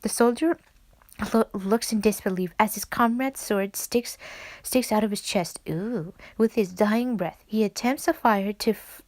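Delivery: usual speaking voice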